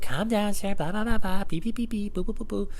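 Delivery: high-pitched mock soothing, trailing into nonsense syllables; placating manager. Manager telling an angry customer to calm down, mock placating. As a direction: imitate a manager calming someone, high and babbling.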